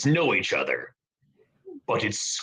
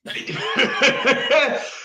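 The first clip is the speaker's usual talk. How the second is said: mocking cackle; 12 semitones higher than usual. Pirate mocks the bird.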